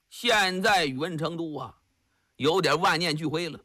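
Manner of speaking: plain reading voice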